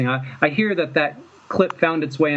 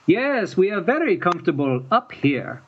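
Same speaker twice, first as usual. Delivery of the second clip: higher-pitched imitation of a French accent; 5 semitones higher than usual. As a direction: echo the French villain's accent from the tapes. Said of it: "speaking along with it".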